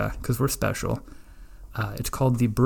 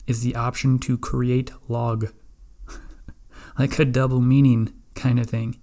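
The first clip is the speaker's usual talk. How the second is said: ordinary talk, nothing marked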